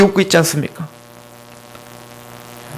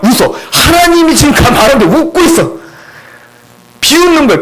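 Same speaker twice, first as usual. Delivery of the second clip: ordinary talk, nothing marked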